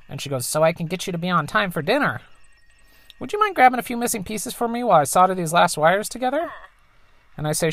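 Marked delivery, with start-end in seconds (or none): 0.5-6.5 higher, lightly put-on mother voice; mom's voice. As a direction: play the tinkering engineer mom, a bit higher. Voices the mom.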